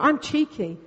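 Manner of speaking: ordinary talk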